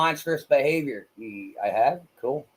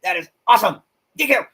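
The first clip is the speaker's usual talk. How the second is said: loud, excited thanks; real excitement, punched out loud; 3 semitones higher, 6 dB louder, much faster than usual. Real, not acted. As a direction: exclaim it with delight. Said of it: "I get excited when I see that".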